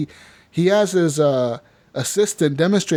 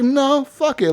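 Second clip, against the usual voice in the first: defiant resolve; 10 semitones higher, much slower than usual. Retold or imitated. Player resolves to really shoot.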